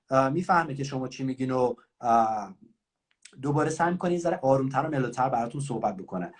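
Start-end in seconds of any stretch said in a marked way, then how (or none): none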